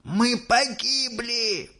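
high, drawn-out wail of doom; despairing cry. A narrator's reading. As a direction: cry it high and slow, as if doomed. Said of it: "закричал дедушка Джо". (отчаянный протяжный вопль)

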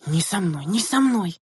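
high, urgent protest, not fully shouted; clamoring refusal. A narrator's reading. Girls refusing to share a seat, clamoring. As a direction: protest in a rush, voice climbing. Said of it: "наперебой закричали девчонки".

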